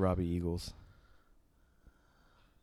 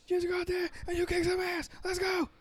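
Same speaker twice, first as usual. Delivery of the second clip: very high excited hype-up voice; hype friend cheering. Retold or imitated. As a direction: cheer him on like a hype man. Imitating Kevin hyping Riddle.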